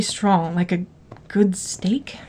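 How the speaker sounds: whispered, savoring; hushed whisper in the dark house. Acted. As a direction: breathe it out in a secret whisper. Hiding, savoring the smell.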